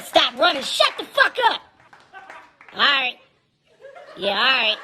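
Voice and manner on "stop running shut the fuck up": angry yell. Retold or imitated. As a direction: yell it, pitch jumping on shut. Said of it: "I said".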